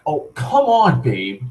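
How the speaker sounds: high-pitched wheedling plea; pleading. Retold or imitated. Drunk Mark coaxing his girlfriend downstairs, pleading. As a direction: beg like a drunk boyfriend, pitch leaping up.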